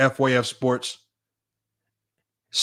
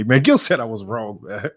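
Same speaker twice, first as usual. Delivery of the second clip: ordinary talk, nothing marked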